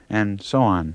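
ordinary talk

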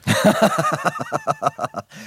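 high, rolling burst of laughter; hearty laugh. Real, not acted. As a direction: genuine amused cackle. Interviewer amused by his own guest.

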